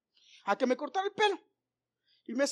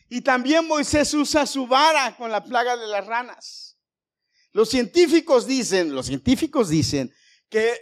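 preaching build; 5 semitones higher, 5 dB louder than usual. Acted.

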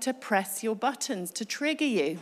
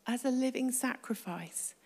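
soft, reverent offering to God; quiet prayer; 7 dB quieter than usual. Shown as a demonstration. Preacher models offering oneself to God, quiet prayer. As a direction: pray it gently, well under speaking level.

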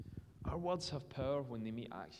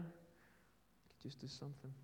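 slow, breathless whisper; 13 dB quieter, slower than usual. Acted. Preacher urging the congregation, slow whisper. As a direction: drop to a slow hush to land it. Sermon challenge, repeated as hush.

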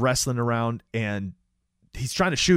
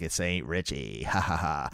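Ghostface voice; 6 semitones lower, 6 dB quieter than usual. Retold or imitated. Masked killer taunting the caller on the phone, low imitated voice. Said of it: "turns on its voice thing".

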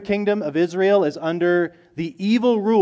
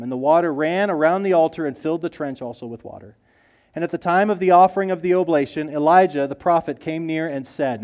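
ordinary delivery

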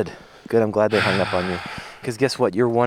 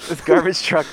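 mock dramatic; much slower than usual. Mock-dramatic line about loud truck.

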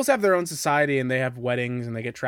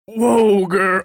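louder, higher cowboy coaxing a horse; Arthur Morgan impression; 7 semitones higher, 9 dB louder than usual. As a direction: call to a horse like a Western outlaw. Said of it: "you do a good Arthur Morgan saying, "Hey, girl" impression".

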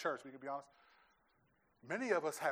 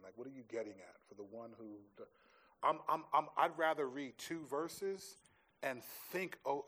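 normal talking voice

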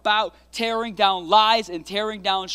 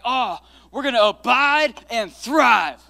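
excited; 4 semitones higher, 8 dB louder, slower than usual. Retold or imitated. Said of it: "And I was like".

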